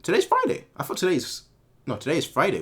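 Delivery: normal talking voice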